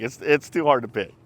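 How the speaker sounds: ordinary talk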